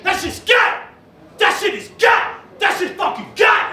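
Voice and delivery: high-pitched imitation of an irate heckler; mock outraged shout. Imitating an outraged audience member outside.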